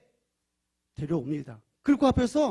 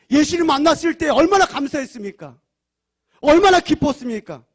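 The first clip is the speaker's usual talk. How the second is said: higher, louder rousing sermon; fervent preaching; 9 semitones higher, 11 dB louder than usual. Acted. Preacher calling believers back to first love, fervent. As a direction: stir the congregation's hearts.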